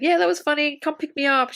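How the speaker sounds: high, lightly acted phone voice; imitated upbeat caller. Imitating Tracy asking Evie for a lift, upbeat. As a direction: act as if in on the joke.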